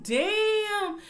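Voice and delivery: high, loud drawn-out damn; stunned exclamation, high and loud; loud shocked damn. Retold or imitated. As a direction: react in loud disbelief. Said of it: "I was like".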